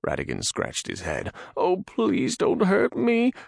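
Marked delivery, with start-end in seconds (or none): 1.6-3.3 high, slow whining plea. Acted. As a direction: whine it fearfully. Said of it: "he whined".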